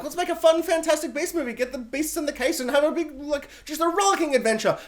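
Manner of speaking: high-pitched, breezy eagerness; mock enthusiastic pitch. Studio pitching the first film, mock enthusiastic. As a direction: pitch it high, as pure fun.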